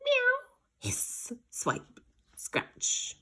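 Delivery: high meow then breathy hissed effects; cat attack effects. Acted. Cats scratching the dog, meow and hisses. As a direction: play the cat fight.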